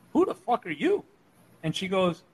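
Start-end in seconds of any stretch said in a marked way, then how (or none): 0.1-1.1 high-pitched retold disbelief; imitated baffled demand. Man at autograph signing to a strange woman, imitated bafflement. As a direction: imitate an incredulous question, pitched up.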